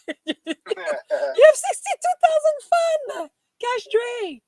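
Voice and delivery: very high, loud, slow shriek with laughter; astonished squeal. Real, not acted. Streamer to Cash Dre about the fans, astonished laughing shriek.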